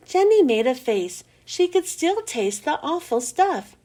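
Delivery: ordinary delivery, nothing marked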